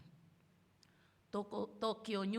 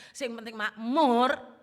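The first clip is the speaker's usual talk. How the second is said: slow, much louder, punching makmur; loud climax; 11 dB louder, slower than usual. Acted. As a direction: drive the list home on makmur. Puncak daftar, jauh lebih keras. (pengkhotbah meneriakkan puncak daftar, pelan dan keras)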